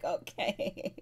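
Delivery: breathy laughing okay; a real laugh swallowing the word. Real, not acted. Reacting to shocking insult.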